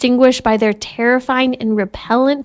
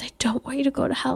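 put-on softer, fast whispered child's plea; 3 semitones higher, 10 dB quieter, much faster than usual. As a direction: whisper it like a child held in arms at bedtime. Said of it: "rocking her in my arms before bed".

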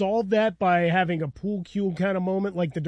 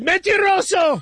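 mom's mock accusation; 10 semitones higher, 3 dB louder, slower than usual. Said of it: "And then she said".

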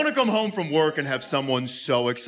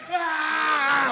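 high-pitched strained mock scream; 13 semitones higher than usual. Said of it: "They're always screaming like".

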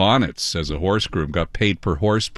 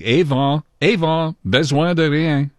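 high-pitched imitation of taunting kids; mock yelling; 8 semitones higher, 3 dB louder than usual. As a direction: mimic a gang calling out, without full volume. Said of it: "followed me yelling".

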